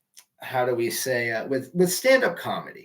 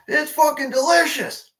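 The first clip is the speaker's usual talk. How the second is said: put-on high, slow drunken yell; retold drunk yell; 13 semitones higher, 4 dB louder than usual. Drunk fan yelling to the comedian on stage, slow and high. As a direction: holler it across the bar. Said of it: "And I yell".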